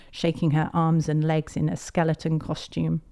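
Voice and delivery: ordinary delivery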